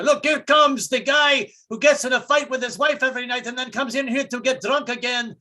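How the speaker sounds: high-pitched comic Greek greeting; Greek restaurateur. Acted. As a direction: announce it like one long Greek name, stretching the ending. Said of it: "the Greeks are notorious for having long names".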